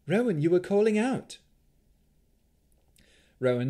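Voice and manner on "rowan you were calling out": higher, raised voice of concern; pressing, high and louder; urgent waking call. A narrator's reading. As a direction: rouse someone from a bad dream. Waking Rowan from a nightmare.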